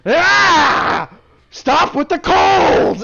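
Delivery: high, loud, slow pained yell; hammed-up howl of pain; pained shout. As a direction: scream it as the blow lands. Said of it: "his face go".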